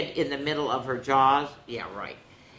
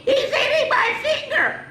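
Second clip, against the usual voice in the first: much higher, louder panicked shriek; a dinosaur crying out as a goldfish bites her finger; 5 dB louder than usual. Acted. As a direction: shriek it out in panic, half laughing. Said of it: "cried Penelope".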